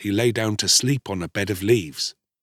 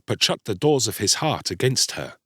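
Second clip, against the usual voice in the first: ordinary delivery, nothing marked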